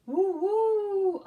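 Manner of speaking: very high, loud hooting "Woo, woo"; high owl hoot. Acted. Owl hoots in picture book.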